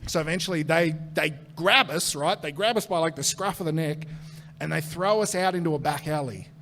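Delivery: ordinary delivery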